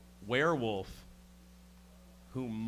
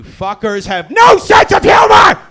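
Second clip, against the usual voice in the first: hammed-up rage at the audience; mock furious shout; 22 dB louder than usual. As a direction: shout it at the crowd, higher. Scolding the audience.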